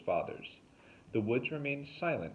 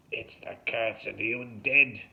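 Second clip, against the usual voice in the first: loud, hammed-up hoarse pronouncement; hoarse decree; 3 semitones higher, 6 dB louder than usual. A narrator's reading. Derek explains his curse, decreeing hoarsely. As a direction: decree it like a doom-laden warning. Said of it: "Derek decreed hoarsely".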